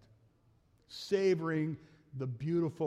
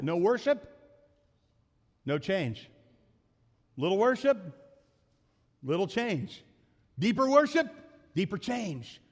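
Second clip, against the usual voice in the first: building refrain; 6 semitones higher, 5 dB louder than usual. Acted.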